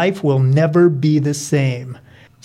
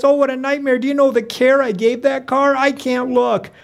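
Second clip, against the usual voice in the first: high-pitched motherly dismay; distressed mom; 9 semitones higher than usual. Acted. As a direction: lament in a high voice, dreading the crash. Mom dreads watching her car wrecked.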